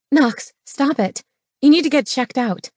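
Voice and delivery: high-pitched urgent woman's voice; alarmed scolding. A narrator's reading. Woman to the man tugging chains, alarmed scolding.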